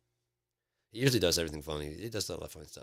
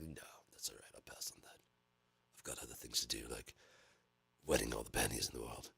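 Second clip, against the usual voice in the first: put-on low, hushed British accent; cool, quiet British turn-down; Idris Elba; 7 semitones lower, 11 dB quieter than usual. Actor turning down a role, soft British accent. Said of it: "Did they want Idris Elba?".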